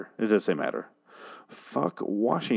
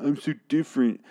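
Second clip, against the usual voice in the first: higher, sneering parody of the singer; mocking whiny voice; 6 semitones higher than usual. Mocking the rapper's lyric to the band, higher and sneering. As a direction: mimic a self-pitying misfit, nasal sneer. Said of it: "You say this in the song".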